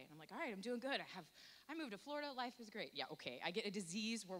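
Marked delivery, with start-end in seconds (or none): 0.3-2.9 forced cheerful. Retold or imitated. Faking being fine after healing.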